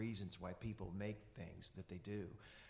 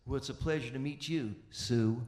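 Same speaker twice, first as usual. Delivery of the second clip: louder, dry put-down that lands hard on Sue; gruff old Monroe; 9 dB louder than usual. Retold or imitated. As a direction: deliver the old man's put-down, punching Sue loud. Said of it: "looks me up and down and says".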